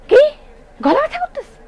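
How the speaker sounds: high, mocking sibling taunt; brothers mocking. Brothers mocking her sore throat, high teasing taunt. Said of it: "আমার ভাইরা আমার দিকে".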